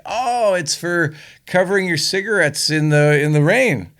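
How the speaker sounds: mock helpful salesman; lightly voiced salesman. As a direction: raise the pitch for a breezy acted fib. Salesman fibs to housewife in joke.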